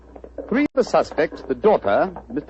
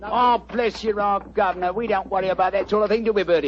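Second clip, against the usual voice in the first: chirpy cockney delivery pitched up; cockney disguise; 4 semitones higher than usual. Acted. Disguised detective greets the homeowner in cockney. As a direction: play a cheeky London workman. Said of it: "That's rhyming slang".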